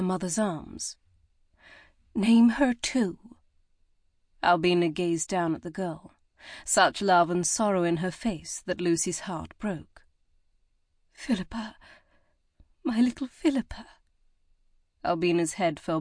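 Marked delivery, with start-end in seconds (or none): 2.1-3.4 slow hushed urging, higher. A narrator's reading. Midwife urges mother gently. 11.1-13.9 higher, whispered with love and sorrow; tender whisper. A narrator's reading. Mother names her living daughter.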